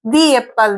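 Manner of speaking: दी said with a long "ee" before एप्पल; the as dee. Shown as a demonstration. Teacher demonstrating दी before a vowel. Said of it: "दी होगा".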